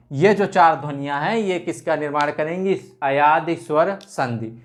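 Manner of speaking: ordinary talk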